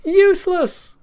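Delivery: very high, loud gleeful cry; the king is laughing so hard his sides ache; laughing shout. A narrator's reading. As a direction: let it burst out mid-laugh. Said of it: "laughing so much that his sides ached".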